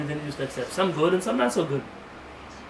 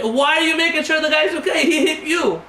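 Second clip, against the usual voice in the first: higher, louder indignant wife; 10 semitones higher, 6 dB louder than usual. Retold or imitated. Wife scolding her husband after the accident, higher and louder. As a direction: demand why he cared, much higher.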